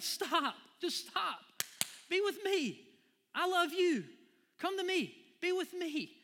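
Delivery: very high, loud, pleading; voicing God. God pleading with his running child, high and loud. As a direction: beg them to stop and come home. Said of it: "And God's like".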